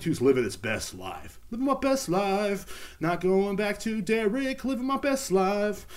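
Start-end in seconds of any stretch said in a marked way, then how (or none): 1.5-5.9 high, slow, far-off party voice; carefree partying voice. Acted. The missing W-2 taunting him, high and partying. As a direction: sing it out like a runaway on vacation. W-2 personified living it up.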